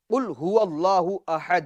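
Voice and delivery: slow measured recitation of the verse; Quran recitation. Shown as a demonstration. Teacher recites the verse slowly for viewers, chanted. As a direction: recite it slowly, lingering on each word.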